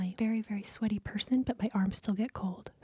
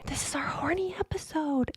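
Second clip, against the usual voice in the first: ASMR whisper; 5 semitones higher than usual. Acted. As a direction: whisper it like a late-night ASMR clip. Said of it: "keep it these, uh, A S M R voices".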